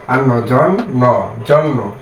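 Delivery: slow, each consonant held apart; slow syllable demo. Shown as a demonstration. Teacher showing students how জ ফলা doubles the sound, slowly. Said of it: "দুইবার উচ্চারণ হয়".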